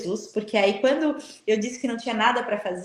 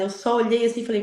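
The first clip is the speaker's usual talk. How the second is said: usual speaking voice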